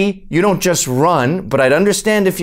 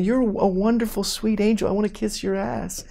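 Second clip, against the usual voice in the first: soft, gushing mock flattery; hushed, sugary fawning; Hillary; 3 semitones higher, 8 dB quieter than usual. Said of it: "we had Hillary chiming in saying".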